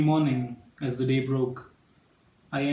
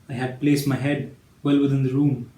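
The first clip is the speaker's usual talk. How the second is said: ordinary delivery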